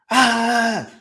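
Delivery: lightly put-on voice of the other parent; mocking the mother. Imitating the child's mother to listeners, mocking.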